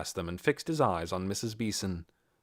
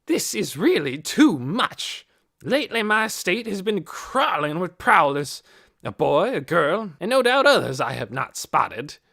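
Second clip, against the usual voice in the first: put-on angry old man; 8 semitones higher, 8 dB louder than usual. A narrator's reading. Hoyt angrily complaining to the police about prowlers. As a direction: rail against the prowlers. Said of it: "His face grew dark with anger".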